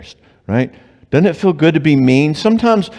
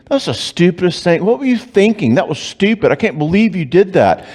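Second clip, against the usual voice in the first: higher, contemptuous put-down; mock mean scolding; 7 semitones higher than usual. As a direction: pretend to belittle your spouse. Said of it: "if I can make you feel terrible".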